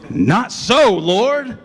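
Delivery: high, loud protest of Peter; indignant outcry. Acted. Peter protesting to God, a high loud indignant cry. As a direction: cry out in shocked refusal. Peter refusing God's command.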